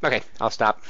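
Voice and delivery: ordinary talk, nothing marked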